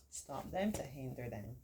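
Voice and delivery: ordinary talk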